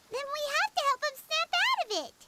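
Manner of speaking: quick urgent outburst. Acted. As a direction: rush it out, eager to help him now. Urgent plea to rescue the son.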